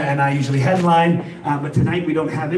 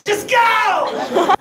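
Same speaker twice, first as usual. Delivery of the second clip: lightly mocking an overly polite driver, higher; 9 semitones higher, slower than usual. Comic to the crowd, mock polite driver. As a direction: mimic the endless "you go" dance.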